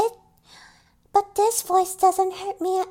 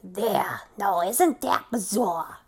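much lower, gravelly smoker voice; chain smoker's voice; 11 semitones lower, 3 dB quieter than usual. Acted. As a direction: rasp it like a heavy smoker. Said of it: "a chain smoker's voice".

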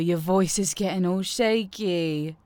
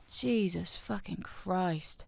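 ordinary delivery

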